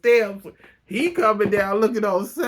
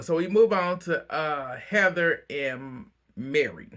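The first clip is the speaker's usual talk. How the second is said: normal talking voice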